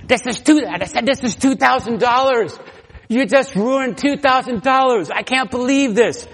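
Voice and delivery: loud, high furious yelling; angry yell. Retold or imitated. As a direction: blow up at the neighbor over the ruined computer. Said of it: "I yelled".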